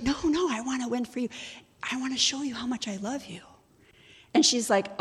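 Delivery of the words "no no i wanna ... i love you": hurried, higher-pitched lover's plea; earnest protest. Retold or imitated. Suitor insisting he loves her, earnest rushed protest. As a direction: plead it quickly, pitched up.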